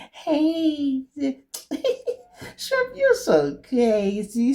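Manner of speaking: put-on high, slow squeaky girly voice with a giggle; mock a girlfriend putting on a cute voice; phony cute voice. Girlfriend being cute with him, mocking phony squeak. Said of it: "using their phony voice".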